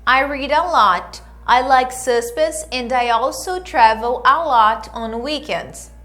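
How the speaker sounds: clear, measured English lesson delivery; model dialogue reading. Acted. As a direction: read each line for them to repeat. Reads dialogue lines for students. (leitura de diálogo modelo)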